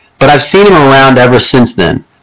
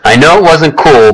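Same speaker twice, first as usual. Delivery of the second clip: usual speaking voice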